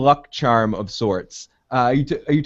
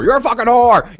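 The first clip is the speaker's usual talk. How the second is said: shouted insult, high and loud; mock furious yell; screaming; 10 semitones higher, 5 dB louder than usual. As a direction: scream it at her.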